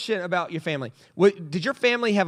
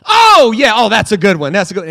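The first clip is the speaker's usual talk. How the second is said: delighted sniff; 3 semitones higher, 14 dB louder than usual. Retold or imitated. Man opening a bag of stinky rugby clothes, loud gleeful relish. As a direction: savour it like a fine wine. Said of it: "it goes like this".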